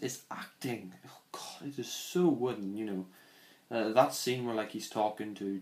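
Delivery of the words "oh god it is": exasperated whisper. Real, not acted. Despairing at wooden acting.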